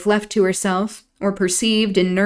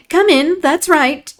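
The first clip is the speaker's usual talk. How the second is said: high bright welcome, louder; 9 semitones higher, 4 dB louder than usual. Acted. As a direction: call them in gaily. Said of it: "exclaimed the mistress, gaily".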